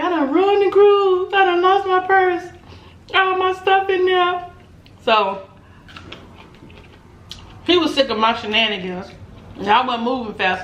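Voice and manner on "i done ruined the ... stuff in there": high, slow mock panic; panicked wail. To her man on the ship, hammed-up panicked wail. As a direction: wail it like the cruise is ruined. Panicking over the lost purse.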